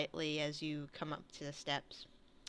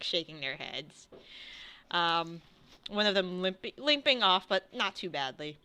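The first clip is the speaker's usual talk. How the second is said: ordinary talk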